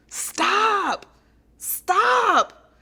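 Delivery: loud, high, drawn-out shout; imitating God's yell. God yelling at her to halt, booming shout. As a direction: boom out a long, high command. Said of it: "yell at me".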